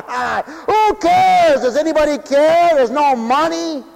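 high, loud, drawn-out lament; anguished preaching. Acted. Preacher voices a struggling minister's despair, loud anguished wail. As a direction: cry out the hardships at full force.

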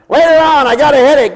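mock whine. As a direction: wail the excuse like a put-upon wife. Wife's excuse, whining.

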